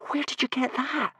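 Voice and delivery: breathy high whisper; startled whisper; gasped. Acted. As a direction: gasp it in shock. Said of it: "gasped Hiccup".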